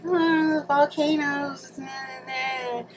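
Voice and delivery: high, drawn-out mocking imitation. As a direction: mimic the naysayers, high and dismissive. Mocking what everybody says.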